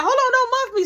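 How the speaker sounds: high, loud, indignant yelp; loud protest. Retold or imitated. Teller objects to Jazz shoving them, loud protest. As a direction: cry out against being pushed.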